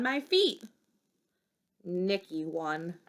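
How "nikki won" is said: usual speaking voice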